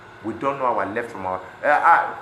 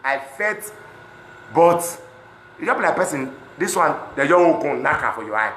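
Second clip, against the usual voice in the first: usual speaking voice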